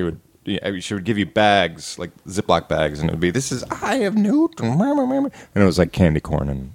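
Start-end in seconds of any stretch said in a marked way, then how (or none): 3.3-5.3 high spooky witch voice; creepy witch handing out treats. Retold or imitated. Witch handing out candy bags.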